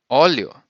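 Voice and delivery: Óleo with the weight on the opening Ó; stress on first syllable. Shown as a demonstration. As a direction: hit the Ó of Óleo hard, let leo fall. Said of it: "strong part of the word is in the beginning".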